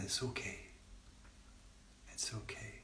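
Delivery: quiet comfort dropping to a whisper; soft reassurance. Real, not acted. Teacher reassures group they're in a process, hushed and soothing. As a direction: say it softly, then barely breathe it.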